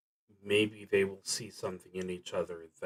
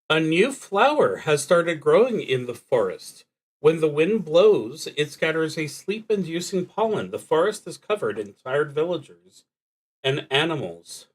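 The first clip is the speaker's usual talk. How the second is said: higher, louder read of the prompt, like an announcement; reading the card aloud; 8 semitones higher, 7 dB louder than usual. Acted. Reading the drawn card's prompt to the audience, higher and louder. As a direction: read the card text out clearly, raised and projected.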